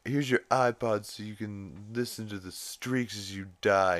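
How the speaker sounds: a hint of Stewie's smug menace; mildly mocking Stewie delivery. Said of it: "he's like".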